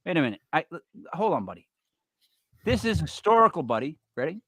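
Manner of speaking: normal talking voice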